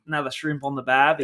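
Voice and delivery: hammed-up Australian accent; a cheesy Aussie Australian accent; bad Aussie accent. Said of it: "we're gonna do some bad Aussie accents".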